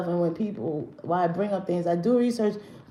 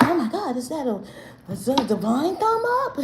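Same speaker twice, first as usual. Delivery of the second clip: mock fan gushing; 5 semitones higher than usual. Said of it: "they like".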